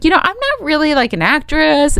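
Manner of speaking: high, meek protest; apologetic excuse. Retold or imitated. Radio host to casting director, apologetic. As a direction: plead it in a lifted pitch, slowing at the end.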